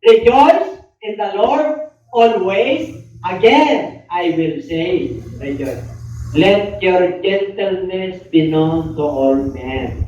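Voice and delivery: slow, deliberate Bible reading; unhurried scripture read to the congregation; solemn scripture reading. Acted. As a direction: read each phrase out slowly like a pulpit lesson. Reads scripture aloud, slowed.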